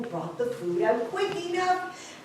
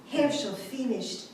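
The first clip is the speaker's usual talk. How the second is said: ordinary delivery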